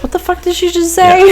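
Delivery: high-pitched mock bafflement; Rip's baffled reaction. Quoting Rip after hearing Teeter talk, high mock bafflement. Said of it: "Rip goes".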